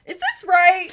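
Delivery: high, loud, laughing question; mock alarm. Remembering doubting her first relationship, mock alarm. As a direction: blurt it out shrill with laughter.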